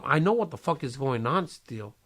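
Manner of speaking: ordinary talk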